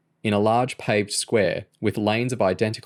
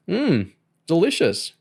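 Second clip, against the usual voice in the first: fake delight; 9 semitones higher, slower than usual. A narrator's reading. James pretends to like stale biscuit.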